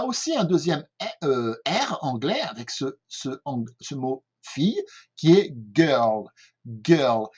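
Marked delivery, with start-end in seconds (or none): 5.7-7.3 put-on "girl" with a held, untrilled R in the middle; English R demo. Shown as a demonstration. Teacher showing learners the second English R, held in "girl". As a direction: draw out the "er" of "girl". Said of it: "vous entendez ce R".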